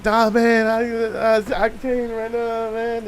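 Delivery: retold tearful brush-off; imitating crying friend. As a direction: imitate a friend too choked up to talk. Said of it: "he's crying".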